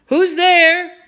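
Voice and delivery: loud, high challenge; shouted. A narrator's reading. Captain challenging hidden intruders, loud high shout. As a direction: shout it into the dark. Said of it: "the captain shouted".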